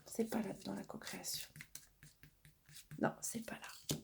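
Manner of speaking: whispered search under her breath; whispering to herself. Real, not acted. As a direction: mutter to yourself while looking for the card. Searching for the right card.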